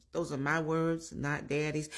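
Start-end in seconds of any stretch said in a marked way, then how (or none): none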